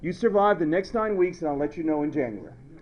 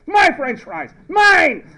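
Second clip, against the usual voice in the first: loud, high-pitched mock outburst; comic shout of ownership; possessive yell; 11 semitones higher, 11 dB louder, slower than usual. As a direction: yell it as if defending your plate. Acts out guarding his fries.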